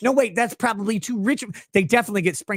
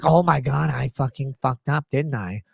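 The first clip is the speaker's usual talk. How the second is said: mock dawning realization; 9 semitones lower than usual. Candace realizing her mistake.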